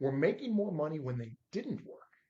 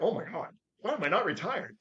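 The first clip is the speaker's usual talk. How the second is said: higher-pitched, swooping astonishment; retold amazed realisation; 7 semitones higher, 4 dB louder than usual. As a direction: act out the moment it dawned on you.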